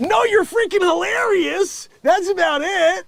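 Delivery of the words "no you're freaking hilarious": ordinary talk, nothing marked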